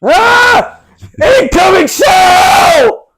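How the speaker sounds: very high, loud, drawn-out yell; battlefield shout. Pretend. Co-host playing soldier in the trenches, screamed warning.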